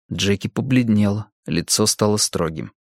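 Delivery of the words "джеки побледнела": ordinary delivery, nothing marked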